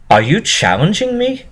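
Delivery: shy pony suddenly barking a challenge; barked. A narrator's reading. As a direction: snap a challenge back. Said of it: "barked at Rainbow Dash".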